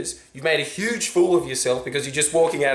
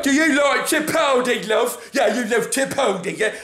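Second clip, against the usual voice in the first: put-on high, cocky British accent; mocking British accent, pitched up; British backpacker; 10 semitones higher than usual. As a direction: chat her up like a smug British backpacker. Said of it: "British backpacker dickhead being like".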